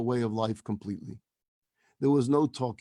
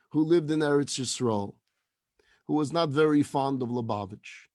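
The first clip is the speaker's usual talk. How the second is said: usual speaking voice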